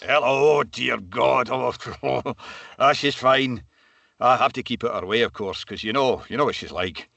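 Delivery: lightly put-on character, flustered then confiding; Brian Scott. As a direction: play a detective sergeant dodging a question about a woman. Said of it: "D S Scott".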